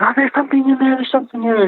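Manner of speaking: contained, high squeal of alarm; retold scream. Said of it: "so she's screaming".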